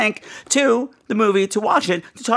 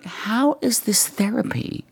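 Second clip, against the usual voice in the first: whisper; 3 semitones higher, 4 dB quieter, slower than usual. Retold or imitated. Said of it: "I'm watching the movie going".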